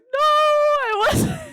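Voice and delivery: high, loud, drawn-out "no, I wasn't"; emphatic denial. Retold or imitated. Telling her boyfriend she wore no jacket, loud and drawn out. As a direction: stretch out a shrill fake-innocent denial.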